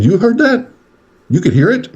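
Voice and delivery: very high, disbelieving acted questions; incredulous. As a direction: squeal in retold astonishment. Retold stunned reaction to son's words.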